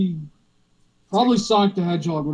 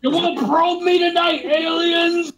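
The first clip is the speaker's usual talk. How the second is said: defiant shout; 9 semitones higher, much slower than usual. Acted. Mock defiance at aliens.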